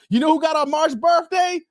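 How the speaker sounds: high, loud hype to chat; excited call-out. Real, not acted. Teasing viewers about his birthday, loud hype. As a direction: build the suspense.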